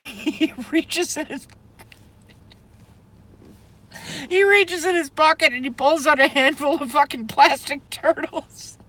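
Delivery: high squeaky words through helpless laughter; giggling too hard to finish the story; laughing fit. Real, not acted. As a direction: barely get it out for laughing. Laughing fit while retelling.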